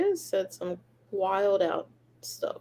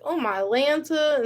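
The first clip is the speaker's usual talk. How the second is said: mock exasperation; 5 semitones higher, 3 dB louder, slower than usual. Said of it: "I was like".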